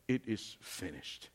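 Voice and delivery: hushed quote. Acted. Quiet quote of Jesus.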